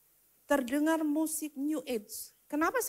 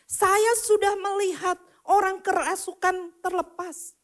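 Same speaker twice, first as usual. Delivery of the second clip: high, raised-voice testimony in driving phrases; impassioned preaching; 7 semitones higher, 5 dB louder than usual. Real, not acted. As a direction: proclaim each miracle to the congregation. Preaches at full force. (khotbah berapi-api dengan nada tinggi)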